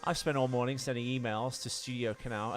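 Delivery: ordinary talk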